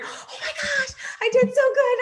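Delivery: very high, loud squealing with a laughing, tearful edge; overjoyed squeal. Retold or imitated. Showing how she used to celebrate a third place, squealing with joy. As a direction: gush like you can't believe you placed. Gushing over her own placing.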